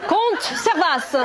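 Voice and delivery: loud, slow, high proclamation; mock medieval announcement. Comedian announcing the count, mock medieval proclamation. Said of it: "Ça fait médiéval un petit peu".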